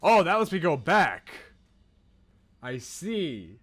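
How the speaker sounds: ordinary talk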